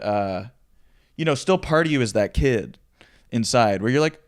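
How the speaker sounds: ordinary talk, nothing marked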